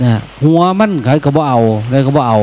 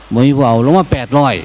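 usual speaking voice

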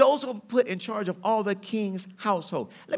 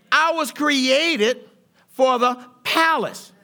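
slow emphatic; 4 semitones higher, 4 dB louder, much slower than usual. Acted.

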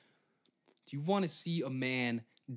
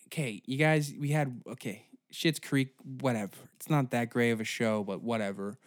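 usual speaking voice

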